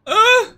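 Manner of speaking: high sharp cry of pain; pained yelp. Real, not acted. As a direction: yelp from the spicy burn. Reacting to burning spicy chip.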